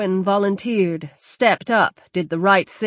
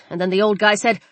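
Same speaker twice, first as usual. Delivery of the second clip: ordinary delivery, nothing marked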